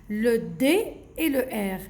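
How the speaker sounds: ordinary talk, nothing marked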